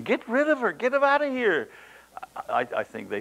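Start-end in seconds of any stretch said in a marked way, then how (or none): 0.0-1.7 urging disciples. Retold or imitated.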